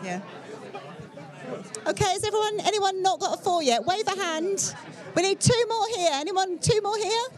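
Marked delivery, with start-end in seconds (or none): 1.8-7.2 raised, higher-pitched voice over the chatter; calling out. Real, not acted. Organiser rounding up groups of four, calling out. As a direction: call across a busy room to get attention.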